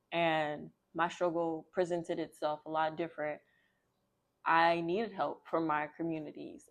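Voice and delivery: plain reading voice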